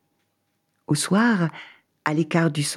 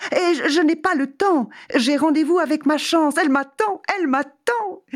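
giddy hurried traveller; 11 semitones higher than usual. Acted. Hurried refusal, chanting.

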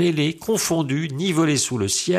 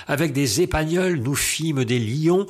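usual speaking voice

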